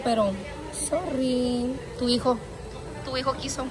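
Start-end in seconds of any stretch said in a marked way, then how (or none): none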